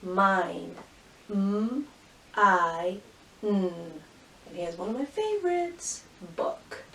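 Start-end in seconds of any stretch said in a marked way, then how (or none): 1.3-3.0 "M-i-n" as held m, eye, held n; sounded out. Shown as a demonstration. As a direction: pause between each sound of "M-i-n". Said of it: "every sound you hear".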